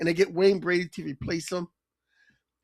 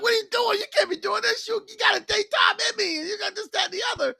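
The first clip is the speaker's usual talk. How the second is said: retold Dave pleading in a high cracking voice; panicked plea; 12 semitones higher, faster than usual. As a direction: beg him to stop, voice cracking high. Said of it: "Dave is like".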